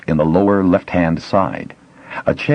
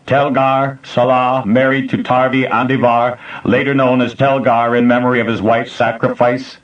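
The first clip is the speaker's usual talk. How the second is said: flat machine voice, higher, no inflection; computer voice; 6 semitones higher than usual. A narrator's reading. Facility computer addressing the intruders, mechanical. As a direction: speak like an old computer.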